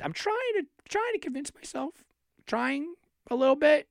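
high, half-hearted self-persuasion; unconvinced inflection. Real, not acted. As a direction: sound like you don't buy your own pitch. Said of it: "you hear my inflection in my voice".

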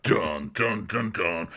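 Game of Thrones style music sting; hummed dramatic sting. Retold or imitated. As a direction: hum a movie suspense sting. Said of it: "It's like".

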